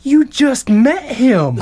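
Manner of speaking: slow, high protest; a mortified kid protesting to mom; incredulous high. Retold or imitated. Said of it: "I'm like".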